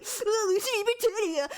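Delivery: high, hammed-up wail of a name; exaggerated crying squeal; mock sobbing. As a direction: blurt the name through fake tears. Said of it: "sobbing my fucking brains out".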